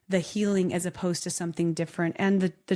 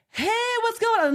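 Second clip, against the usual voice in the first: much higher and louder, bubbly sunshine voice; fake cheery greeting; 4 dB louder than usual. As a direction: play the overly sunny girl. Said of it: "I'm not saying that I'm just like".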